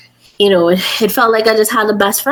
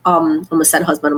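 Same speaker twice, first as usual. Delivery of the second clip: ordinary talk, nothing marked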